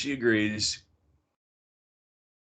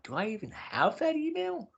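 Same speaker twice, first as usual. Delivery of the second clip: higher, softer bewildered wondering; baffled; 13 semitones higher, 5 dB quieter than usual. Retold or imitated. Sitting in her driveway, softer higher baffled muttering. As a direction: puzzle it out aloud, high and hushed. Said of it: "I'm like".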